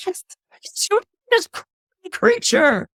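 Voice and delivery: high-pitched, sputtering outrage; acted fury, spitting out broken words way up high; outraged. Queen rages at the nursemaid.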